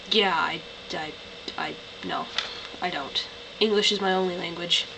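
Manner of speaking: ordinary talk, nothing marked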